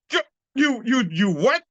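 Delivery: high cracking stunned stammer. Retold or imitated. Teacher stammering high at the claim, stunned.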